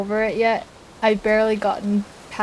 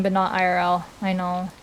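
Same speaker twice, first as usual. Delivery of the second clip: ordinary delivery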